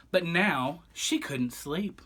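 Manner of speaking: ordinary delivery, nothing marked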